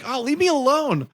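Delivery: high-pitched, mock-exasperated brush-off; imitated annoyed sister. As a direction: imitate a sister shooing off a nosy sibling, voice shooting up high. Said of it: "Before Alana's like".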